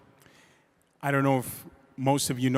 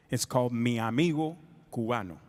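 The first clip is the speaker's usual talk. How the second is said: ordinary talk, nothing marked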